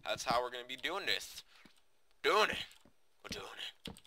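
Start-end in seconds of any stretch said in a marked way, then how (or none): 2.2-2.6 high, loud burst of creeper voice. Pretend. Gamer goofing for viewers on how episodes will go, high loud creeper voice. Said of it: "went in that creeper voice". 3.2-3.9 creepy whispered echo; creeper voice. Pretend. Gamer goofing for viewers on how episodes will go, whispered creeper voice. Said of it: "went in that creeper voice".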